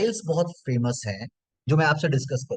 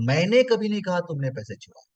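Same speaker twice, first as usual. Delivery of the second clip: "मैंने" lifted and lengthened, then a steady fall; stress on मैंने. Shown as a demonstration. Trainer repeating the first sentence, stress on मैंने. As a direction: lean hard on "मैंने" at the start. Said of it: "पहला sentence है".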